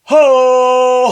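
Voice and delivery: high shouted cry; battle cry. Acted. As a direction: belt it like a war whoop.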